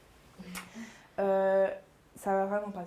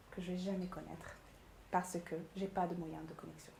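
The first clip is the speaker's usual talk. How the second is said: low, soft, confiding delivery; lowered, intimate voice; 5 semitones lower, 9 dB quieter than usual. Shown as a demonstration. Pitch coach showing emotion through a lowered voice, low and intimate. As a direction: drop the voice and share it softly, with pauses. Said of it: "si on baisse la voix".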